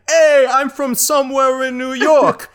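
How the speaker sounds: loud, high, hammed-up New York accent; brash New York guy. Extra in the movie talks in a loud New York accent. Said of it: "or you're like".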